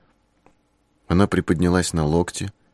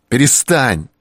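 loud, high yell; shout; 6 semitones higher, 4 dB louder, slower than usual. A narrator's reading. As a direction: bellow it out. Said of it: "заорал я".

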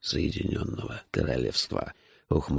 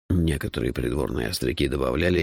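plain reading voice

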